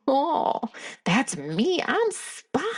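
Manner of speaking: put-on high childlike voice, eager to be seen; inner child voice. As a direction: play the little inner child who has just been recognised. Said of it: "the inner child me is like".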